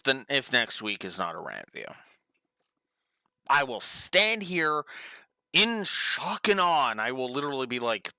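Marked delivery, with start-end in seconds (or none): 3.5-6.9 slow, emphatic, building; dramatic slow delivery. Acted. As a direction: draw it out dramatically.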